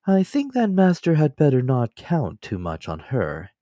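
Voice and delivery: a loyal manservant, calm and deferential; Counsel. Acted. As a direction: servant offering his shoulder to his master, politely. Servant Counsel speaks.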